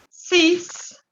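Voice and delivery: ordinary delivery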